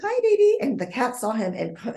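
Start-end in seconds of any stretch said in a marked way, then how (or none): 0.0-0.6 put-on high cooing hi baby; baby talk to a cat. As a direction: coo at the little cat, much higher.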